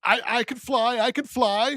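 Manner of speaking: mock delirious claim of flying; a hint of the raving witch; freaked-out witch. Imitating the freaked-out witch from the film.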